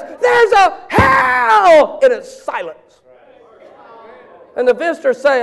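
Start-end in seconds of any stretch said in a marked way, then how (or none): 0.0-2.0 much higher, very slow, shouted proclamation; pastor preaching hell. Retold or imitated. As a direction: thunder it from the pulpit. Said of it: "when the pastor says".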